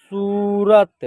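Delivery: सूरत with a long held first vowel; drawn-out model reading. Shown as a demonstration. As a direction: stretch the ू in सूरत. Teacher models the blended word.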